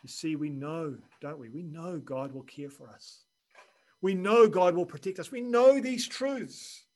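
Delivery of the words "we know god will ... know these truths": louder, higher, slowing on these truths; preaching crescendo. Real, not acted. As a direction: proclaim each truth, building. Preacher builds emphatic declaration.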